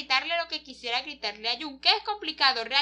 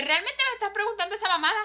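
exasperated outburst; 7 semitones higher, 5 dB louder, faster than usual. Acted. Jun snaps at doctor in quarrel.

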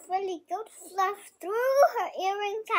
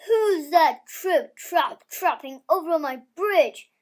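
loud, hammed-up troll challenge; a child's loud troll roar; 2 semitones lower, 6 dB louder than usual. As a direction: boom it out like a storybook troll from under the bridge. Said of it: "roared the troll".

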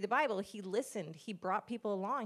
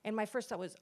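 normal talking voice

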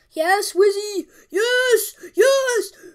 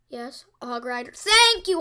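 normal talking voice

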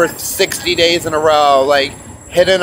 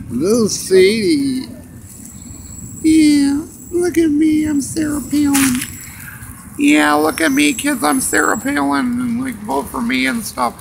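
much higher, put-on mocking politician voice; Sarah Palin impression; 8 semitones higher than usual. Ranter mocking a politician on camera, high mocking put-on voice. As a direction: squeak it up high like a vain candidate showing off. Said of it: "I'm gonna do my Sarah Palin impersonation".

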